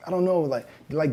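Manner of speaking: ordinary talk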